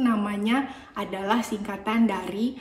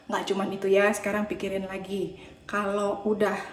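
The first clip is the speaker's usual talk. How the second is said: ordinary talk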